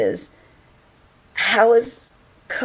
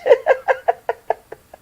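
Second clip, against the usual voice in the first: high, helpless laugh. Real, not acted. Streamer reacting to a viewer's message, laughing. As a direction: burst out in real giggles. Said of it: "I'm just laughing".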